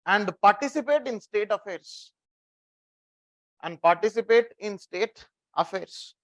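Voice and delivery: slow, even dictation pace for note-taking. Shown as a demonstration. Teacher dictating a line to students, slow and measured. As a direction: spell it out slowly so they can write. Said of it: "Ah, write down one line".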